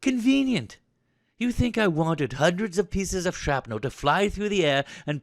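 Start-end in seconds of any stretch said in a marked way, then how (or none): 0.0-0.8 high, incredulous repeat; indignant echo. Acted. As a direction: throw the word back in outrage. Officer throws the word back.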